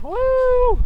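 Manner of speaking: high joyful whoop; excited whoop. Real, not acted. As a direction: let out a happy yell. Cheering on reaching the top.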